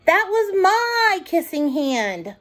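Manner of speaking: put-on mild child's sorrow; saddest voice. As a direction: gasp it out like a hurt little boy. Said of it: "in the saddest voice".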